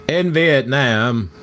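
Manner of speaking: slow, drawn-out Southern drawl; Forrest Gump. Acted. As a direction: stretch it out in a bad Southern accent. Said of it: "I was doing Gump".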